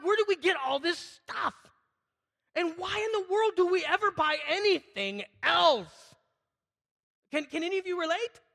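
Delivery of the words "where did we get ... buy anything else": high, loud and slow, fed up; exasperated rant. Retold or imitated. Pastor venting to his wife about clutter, loud and exasperated. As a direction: throw up your hands at all the stuff. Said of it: "I'm getting irritated".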